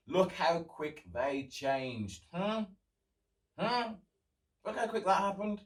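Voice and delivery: slow, pointed sarcasm; knowing, mocking remark; sarcastic jab. Real, not acted. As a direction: rub it in slowly. Mocking Proud Boys hypocrisy.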